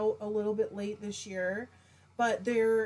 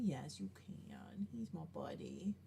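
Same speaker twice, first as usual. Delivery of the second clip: cooing to pet; 7 semitones lower, 11 dB quieter than usual. Real, not acted. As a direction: coo to the little buddy on camera. Showing her pet to viewers.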